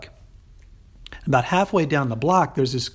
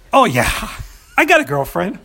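higher and louder hurried yes; nervous bluff; 7 semitones higher, 5 dB louder than usual. Retold or imitated. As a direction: fake confidence to a scary guy. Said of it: "I'm like".

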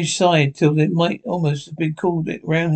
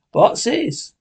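rough sailor voice; 6 semitones higher than usual. A narrator's reading. Cook questions the crew.

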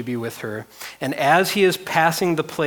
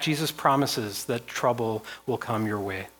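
ordinary delivery

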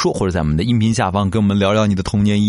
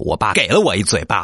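normal talking voice

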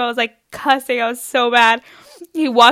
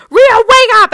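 high, shouted wake-up call; loud mock-mom yell; mom waking kid; 12 semitones higher, 11 dB louder than usual. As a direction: holler it like a mother at the bedroom door. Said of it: "my mom walking into my bedroom".

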